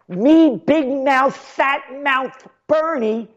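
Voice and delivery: high, slow mocking whine; mocking Bernie voice. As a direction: lampoon him as a braggart. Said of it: "And you know what Bernie said?".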